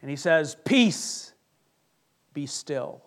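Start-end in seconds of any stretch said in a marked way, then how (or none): 0.6-1.3 one higher, firm word of command; calming command. Acted. Jesus commanding the storm. 2.3-3.0 lower, softer, settling; hushed command. Acted. Jesus stilling the storm.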